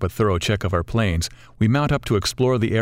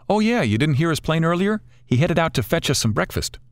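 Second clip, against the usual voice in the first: higher-pitched, upbeat friend's voice; lively morning reply in a friend's higher voice; energetic Rich; 8 semitones higher than usual. A narrator's reading. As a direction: pitch it up, perky and informative.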